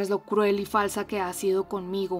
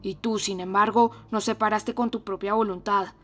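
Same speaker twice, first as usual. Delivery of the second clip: loud, fierce reproach; ferocious; 7 dB louder than usual. Acted. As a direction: hurl the blame at her, fiercely. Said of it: "le dijo con acento feroz".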